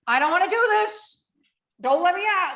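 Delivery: high, loud mock panic; panicked plea. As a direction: beg like you're terrified at the plane door. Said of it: "And now I'm like".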